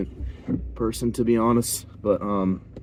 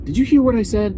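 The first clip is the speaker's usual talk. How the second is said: much higher, mock teacher demand; pitched-up scolding question; imitated stern challenge; 12 semitones higher, 4 dB louder than usual.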